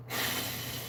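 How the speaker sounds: long, soft exasperated exhale; quiet breath of exasperation; weary sigh. Real, not acted. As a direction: let out a resigned sigh. Sighs at being mistaken again.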